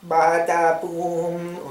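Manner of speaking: high, slow squeaky mimicry of her voice; mocking a grating movie voice; mock annoying voice. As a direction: drag it out in a silly high voice.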